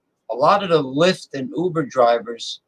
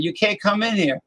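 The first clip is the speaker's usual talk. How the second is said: put-on Southern accent; 6 semitones higher than usual. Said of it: "with the Southern accent".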